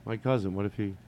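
ordinary talk, nothing marked

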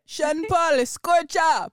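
bad Jamaican accent, high and slow; hammy Jamaican accent, drawn out. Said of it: "not my [name] Jamaican accent".

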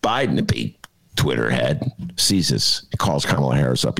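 ordinary delivery

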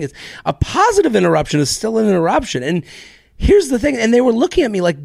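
ordinary talk, nothing marked

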